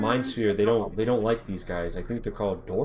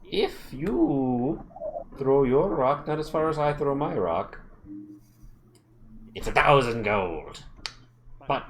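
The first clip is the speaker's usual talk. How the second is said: dwarf chief; 5 semitones higher than usual. Acted. Dwarf sets the terms.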